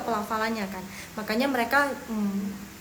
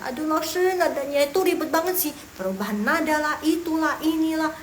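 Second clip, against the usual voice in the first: higher-pitched exasperated student griping; playful imitation of a pupil's grumbling; mock student complaint; 6 semitones higher, 4 dB louder than usual. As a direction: whine lightly as a fed-up pupil. Voices a student complaining to teacher.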